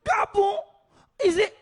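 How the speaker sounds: high, loud, amazed outburst; excited shout. Retold or imitated. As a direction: explode with delight at the answer. Acting an excited Moroccan local.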